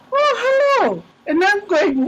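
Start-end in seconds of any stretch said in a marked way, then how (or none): 0.1-1.0 high bright surprised hello; a sudden high oh hello. Retold or imitated. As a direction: act startled to hear him. Phone greeting, surprised.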